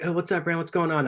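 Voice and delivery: high, casual mock hello; acted friendly greeting. Gym people greeting the speaker, imitated friendly hail. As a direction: pitch it up, imitating gym regulars.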